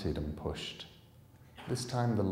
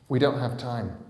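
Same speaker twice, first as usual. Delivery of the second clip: urgent reply; 5 semitones higher, 8 dB louder than usual. Acted. Bod urgent.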